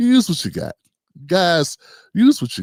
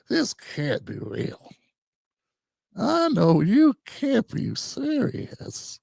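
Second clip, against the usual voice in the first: Cat Williams voice; 4 semitones higher, 4 dB quieter, much slower than usual. Retold or imitated. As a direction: drop into a slow Cat Williams bit of disbelief. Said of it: "Went into my Cat Williams voice".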